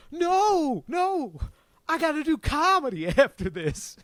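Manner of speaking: high, pleading mock plea; pleading comic.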